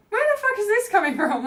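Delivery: high, loud, laughing disbelief; a laughing, shocked cry, pitched high and loud; incredulous outburst. Retold or imitated. Arguing with inner nasty voice.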